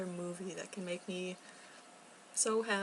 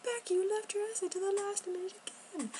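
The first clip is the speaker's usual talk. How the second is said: higher mock self-scolding; scolding herself; 12 semitones higher than usual. Becky telling herself off, high mock scolding. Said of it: "I'm like".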